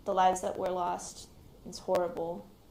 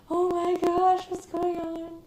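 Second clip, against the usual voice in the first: much higher, louder alarmed cry of worry; worried family; 11 semitones higher, 8 dB louder, slower than usual. Retold or imitated. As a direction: imitate panicked relatives on the call. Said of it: "they're on the other side going".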